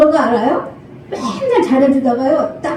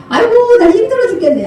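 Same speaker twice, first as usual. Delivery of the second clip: mock fuss; 6 semitones higher, 4 dB louder, faster than usual. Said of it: "엄살 부려요".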